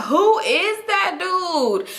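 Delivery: high, drawn-out "who is that"; acted-out incredulous reaction; incredulous question. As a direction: stretch it out in high-pitched disbelief. Acting out disbelief at a stranger.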